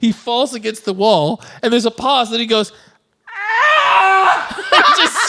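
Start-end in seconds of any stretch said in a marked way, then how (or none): 3.3-4.6 high-pitched imitated scream; hammed-up high yell; enraged scream. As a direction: shriek like a comically furious villain. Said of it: "he just screams".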